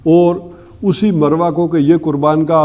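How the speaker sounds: normal talking voice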